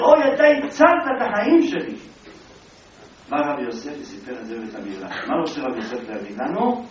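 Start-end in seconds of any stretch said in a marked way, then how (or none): 0.0-2.0 high, loud cry of relief; grateful exclamation. Retold or imitated. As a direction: burst out thanking the thorn. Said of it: "הוא אומר". (קריאת הודיה נרגשת)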